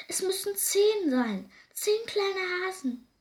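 high, slow, emphatic dismay; alarmed mother. A narrator's reading. Hare mother insisting on the count, alarmed and emphatic. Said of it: "fragt die Hasenmutter erschrocken".

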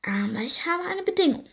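lightly hissed, sly fairy voice; a hint of a scheming fairy; hissing evil fairy. A narrator's reading. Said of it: "zischelte die böse Fee".

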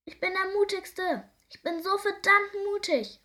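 high, loud crowing; triumphant loud boast; boastful shout. Acted. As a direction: shout it like a proud little hero. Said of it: "ruft Nick".

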